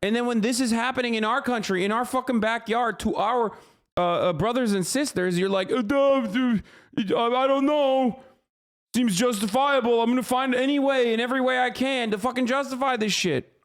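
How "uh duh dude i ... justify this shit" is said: high, dopey mock voice; mock dumb guy. Mocking people who excuse police raids, in a high dopey voice. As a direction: play a clueless guy shrugging it off.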